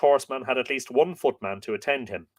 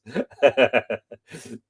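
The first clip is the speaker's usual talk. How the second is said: laugh. Real, not acted. Laughs after the Coke joke.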